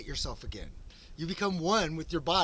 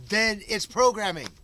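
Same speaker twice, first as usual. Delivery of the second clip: ordinary delivery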